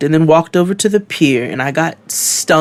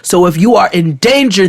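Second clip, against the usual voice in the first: ordinary talk, nothing marked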